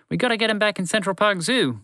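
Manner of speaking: rally the group to chase him; shouted. A narrator's reading. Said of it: "someone shouted".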